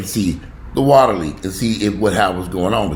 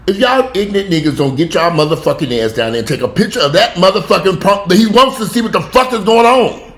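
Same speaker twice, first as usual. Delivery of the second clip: loud furious cussing, rising higher; 10 semitones higher, 6 dB louder than usual. Retold or imitated. Cussing out her brother on the phone, furious yelling. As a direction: yell it at the idiots, escalating. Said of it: "start cussing him out".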